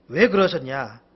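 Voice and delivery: normal talking voice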